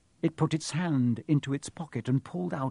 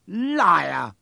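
loud, higher-pitched outburst; sudden shout; 4 semitones higher, 7 dB louder than usual. A narrator's reading. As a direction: shout the accusation out. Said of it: "Harry shouted suddenly".

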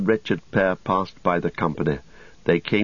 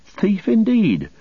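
high, loud, affronted protest; indignant; 4 dB louder than usual. Acted. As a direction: throw the accusation back, outraged. Pippin indignant at being called thief.

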